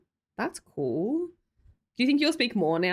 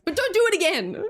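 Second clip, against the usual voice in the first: higher-pitched playful warning; mock scolding; 8 semitones higher, 4 dB louder than usual. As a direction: scold them as a joke, pitch jumping up. Mock warning after accepting an apology.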